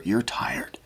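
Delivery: breathy whisper of sympathy; real hushed whisper. Real, not acted. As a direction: whisper it gently to them. Empathic card reading to viewer.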